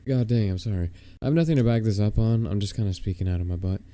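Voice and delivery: ordinary talk, nothing marked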